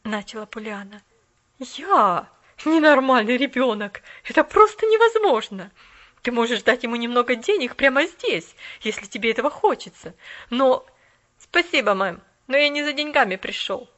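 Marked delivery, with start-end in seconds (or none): 1.6-10.1 loud, shocked scolding; outraged lady, loud. A narrator's reading. 10.5-13.9 loud, proud refusal; boy insulted by money; indignant boy, raised. A narrator's reading. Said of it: "с негодованием ответил мальчик".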